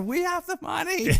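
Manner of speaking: high, strained calling out; imitated desperate announcement; mock frantic cry. Runs from car waving suitcase.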